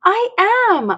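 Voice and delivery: mock indignant cry; crying out in protest. Dory protests to her mom, crying out. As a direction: protest it as a child. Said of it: "I cry".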